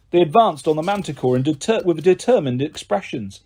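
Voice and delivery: plain reading voice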